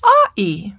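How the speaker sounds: "Aw-ee" as two bare vowels, no d or g; toddler's vowels only. Retold or imitated. Teacher repeats the toddler's attempt, "Aw-ee" in vowels alone. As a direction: say doggy without its consonants, "Aw-ee". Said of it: "he says".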